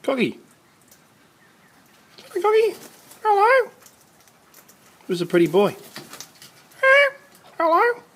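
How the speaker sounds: very high, loud sing-song calls to a cockatoo; bird-talk voice. Real, not acted. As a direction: call up to the parrot like talking to a pet bird. Calling to cockatoos again.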